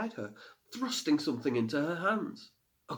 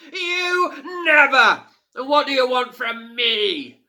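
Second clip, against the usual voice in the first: loud, high, slow scornful boom; mocking fire fiend; 13 semitones higher, 11 dB louder, slower than usual. A narrator's reading. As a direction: sneer down at the tiny girl.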